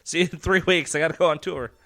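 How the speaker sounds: lightly acted musician brushing off family; mock rock dad. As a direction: play the carefree touring dad leaving. Mocking musician leaving family.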